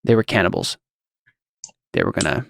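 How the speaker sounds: subdued, dropping low on an unfinished thought; breaking off mid-sentence. Acted. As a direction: let the sentence sink and stop short. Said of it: "stop the sentence there".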